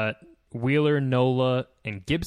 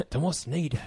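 put-on higher joking British accent; 4 semitones higher than usual. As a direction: try out a mock British accent. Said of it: "in a British accent".

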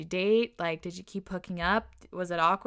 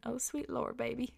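softer, higher mock Southern accent; cute, quiet Southern exclamation; 4 semitones higher, 7 dB quieter than usual. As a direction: gently imitate the Southern uncle. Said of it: "in his southern accent".

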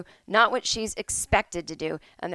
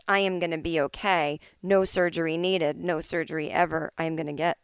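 usual speaking voice

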